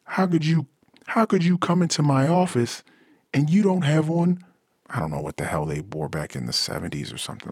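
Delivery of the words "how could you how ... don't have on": high, incredulous scolding; high-pitched outraged office boss; exasperated boss. Acted. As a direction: berate him like an outraged boss. Acted boss scolding in improv.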